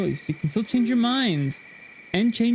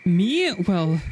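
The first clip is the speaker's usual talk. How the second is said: flustered surprise; 3 semitones higher, much slower than usual. Acted. Voices flustered male lead.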